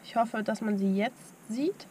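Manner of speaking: ordinary delivery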